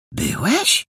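high-pitched, astonished query; amazed question. A narrator's reading. Lady inspector hears he was there, astonished. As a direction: ask it in amazement, voice leaping up. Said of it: "zdumiała się pani inspektor".

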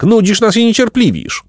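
high, loud and impatient; shouting. Acted. Jacek yelling at the old man, impatient. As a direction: spit it out at him. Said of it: "krzyknął Jacek".

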